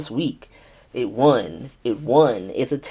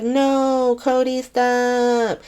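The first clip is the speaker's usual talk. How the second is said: high, slow, stretched-out plea; drawn-out mock protest; 8 semitones higher, much slower than usual. [name] begging Cody to stop, drawn-out mock protest. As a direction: imitate a long wailed "stop" without real distress.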